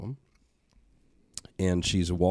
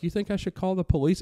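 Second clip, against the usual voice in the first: high, timid questioning voice; timid imitation; 13 semitones higher, faster than usual. Daughter asks her father about calling police, meek. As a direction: ask it meekly, pitched up. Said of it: "she's like".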